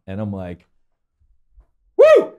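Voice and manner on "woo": loud, high triumphant woo; whooping cheer. Retold or imitated. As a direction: let out a wild whoop of relief. Relief after climbing away.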